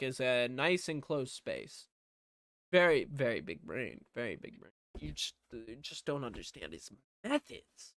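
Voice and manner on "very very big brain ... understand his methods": low, hushed sarcastic aside; mock admiration. Speaker defending Hank's closet hideout, mock admiration. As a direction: softly feign deep respect for his genius.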